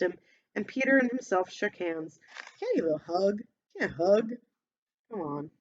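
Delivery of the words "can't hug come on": usual speaking voice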